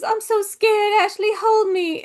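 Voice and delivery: mocking Scarlett's clingy plea to Ashley; mock damsel. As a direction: ham up a swooning damsel begging to be held.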